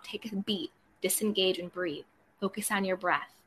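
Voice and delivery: hushed, settling coaching voice; calming. Shown as a demonstration. Coach talking listeners out of an online fight, calming. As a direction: drop to a soft, soothing tone. Coaching someone to disengage and breathe.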